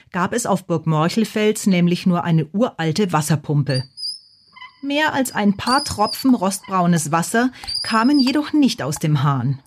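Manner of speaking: ordinary delivery, nothing marked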